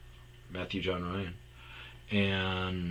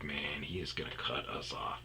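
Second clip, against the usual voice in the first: softer, whispered dread; whispered worry; 6 semitones lower, 11 dB quieter than usual. Retold or imitated. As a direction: whisper it behind the boss's back. Coworkers fearing cut-off.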